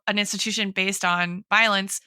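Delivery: ordinary delivery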